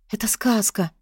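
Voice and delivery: breathless whisper of wonder; awed whisper. Acted. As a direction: whisper it in amazement. Bri awed entering ballroom.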